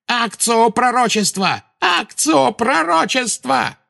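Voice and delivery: high, drawn-out shrieked incantation; frantic spell cry. A narrator's reading. Witch desperately summons the prophecy, shrieking the incantation.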